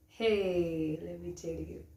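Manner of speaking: ordinary delivery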